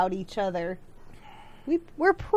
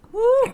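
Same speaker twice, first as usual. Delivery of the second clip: hot sauce whoop; 12 semitones higher, 7 dB louder than usual. Real, not acted.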